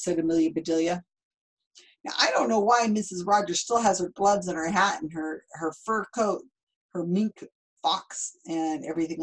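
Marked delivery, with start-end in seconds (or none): none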